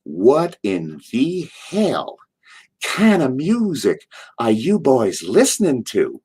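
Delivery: put-on slow, drawled Southern growl of an old legend; wrestling legend scolding the young drivers about the radio; Dusty Rhodes impression. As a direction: lean into the Dusty drawl, disgusted.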